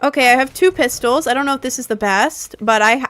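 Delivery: usual speaking voice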